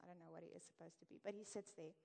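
ordinary talk

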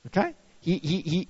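normal talking voice

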